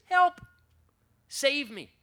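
sudden high shout of help; desperate cry for help. Acted. Peter sinking in the storm, shouting for help. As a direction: yell it like a drowning man.

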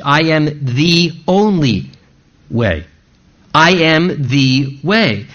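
"only" held and stressed in "I am the only way", then "way" dropped low; the single path, underlined. Shown as a demonstration. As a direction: lean hard on "only", then let "way" fall away. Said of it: "It's in the emphatic in the language".

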